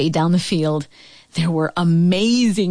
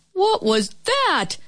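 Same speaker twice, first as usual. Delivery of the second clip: high, drawn-out startled question; mild shout; 10 semitones higher, slower than usual. A narrator's reading. Father startled by the flying ball, high mild shout. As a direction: exclaim it slowly, pitched up in surprise. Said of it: "shouted Annie's father".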